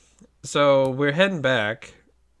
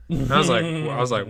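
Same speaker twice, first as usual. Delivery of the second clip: usual speaking voice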